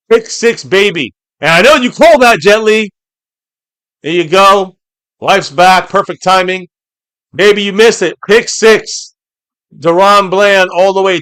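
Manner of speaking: excited celebration. Real, not acted. Streamer hyping the pick six to chat member Jet Li, excitedly. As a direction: keep the hype going, loud.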